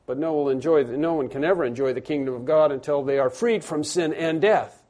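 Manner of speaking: ordinary talk, nothing marked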